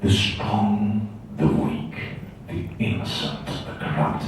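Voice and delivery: low, slow, hushed sinister villain voice; Darth Maul. Retold or imitated. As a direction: intone each phrase darkly, with menacing pauses. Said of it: "Darth Maul had one where he goes".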